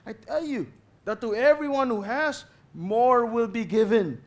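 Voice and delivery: high, loud, slow master proclaiming; master's voice. Acted. Master answering the servants, loud and high.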